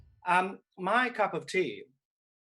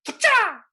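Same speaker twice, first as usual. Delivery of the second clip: loud, high "fa-cha" burst; explosive stage comma; 13 dB louder than usual. Shown as a demonstration. Actress shows how to act a comma, explosive cry. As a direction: punch out a spoken comma with a shriek. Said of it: "to show a comma, you go like this".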